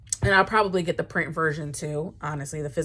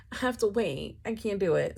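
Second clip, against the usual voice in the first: usual speaking voice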